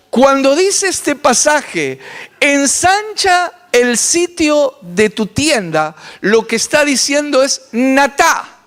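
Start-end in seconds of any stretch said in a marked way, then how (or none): 2.4-6.0 slow, raised quoting of the verse; solemn scripture quote. Acted. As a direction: draw the verse out slowly and solemnly. (cita solemne y lenta del versículo)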